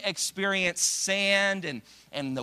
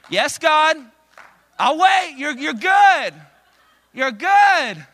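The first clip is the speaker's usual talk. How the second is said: much higher, louder yes God; mock eager agreement; 10 semitones higher, 12 dB louder, slower than usual. Believers to God, loud high mock eager agreement. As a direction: gush it loud and high, not meaning it.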